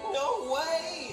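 high-pitched scared refusal; fearful sailor. A narrator's reading. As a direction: balk like a frightened young sailor. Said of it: "with fear in his throat".

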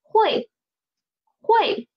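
会 said high and crisp on its own, twice; isolated 会, high start, for learners to echo; model for repeating. Shown as a demonstration. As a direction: say 会 as a model to copy, pitched high. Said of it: "Read after me, please".

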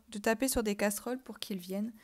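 usual speaking voice

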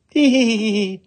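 high hee-hee giggle; irrepressible tittering. Acted. Little woman hiding, cannot keep from laughing, high titter. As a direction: burst into a high giggle mid-scene. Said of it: "could not keep from laughing".

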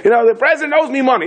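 high-pitched madman's cry; mock screaming. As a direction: imitate a crank wailing a wild claim. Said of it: "screaming, smashing his head in".

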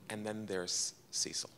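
hushed reveal, nearly breathless; dramatic whisper. Real, not acted. Whispering to the audience the new dog's name. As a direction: whisper it like unveiling a secret.

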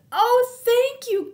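high, loud delighted cat. Acted. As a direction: squeal thanks like a cat. Cat thanks Cow.

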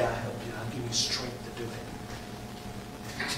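very soft, low, slow voice of God; almost whisper it, slowly; gentle divine promise, barely voiced; hushed reassurance. Voices God's reassurance softly.

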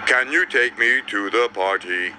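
low, put-on character voice; deep-voiced musician character, even-toned; tuba player voice. A narrator's reading. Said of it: "he asked".

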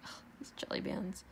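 frustrated whisper under breath; whispered grumble. Real, not acted. Annoyed aside to herself.

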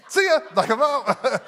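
very high, loud cartoonish see ya; mock bailing out. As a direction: flee the scene in a squeak. Wishing to teleport away.

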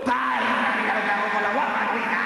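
hammed-up shouting; hysterical outburst. Nervous performer losing control on stage, shouted outburst. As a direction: burst out in a theatrical fit.

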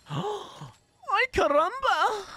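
very high, gasped exclamation; cartoonish surprise; high gasping ay caramba in jest; mock surprise. Gasp and catchphrase exclamation.

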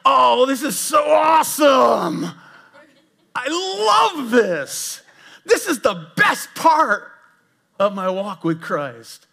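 high, slow, hammed-up sarcastic joy; overdone fake cheer, drawn out and high; mock delight. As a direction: gush like you love the pain.

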